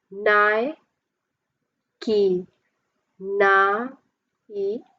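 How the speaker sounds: "Nike" drawn out in separate beats; syllable by syllable. Shown as a demonstration. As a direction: sound out "Nike" with long gaps between syllables. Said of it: "three syllable".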